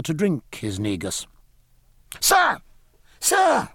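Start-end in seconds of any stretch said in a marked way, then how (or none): 2.2-3.7 put-on high, urgent boyish call; high-pitched eager shout for attention; young gentleman crying out. A narrator's reading. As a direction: call out urgently as an excited lad. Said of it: "cried a scarlet young gentleman".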